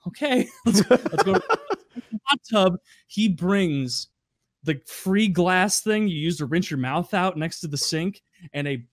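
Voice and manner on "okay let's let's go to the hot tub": sigh, then laughing okay; resigned laugh. Retold or imitated. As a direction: give in with a laugh. Narrator giving in.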